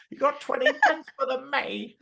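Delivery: high-pitched caricature of a chap; hammed-up comic street character; put-on chap voice. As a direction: beg for pennies in a silly, high voice. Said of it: "I sound like a chap".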